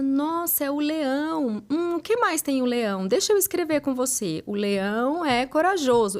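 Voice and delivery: acted playful encouragement; encouraging a child. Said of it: "você fala".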